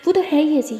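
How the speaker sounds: put-on mother angrily blaming the girl, loud; angry scolding. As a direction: let the blame pour out. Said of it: "तारा पे गुस्सा करने लग जाती है".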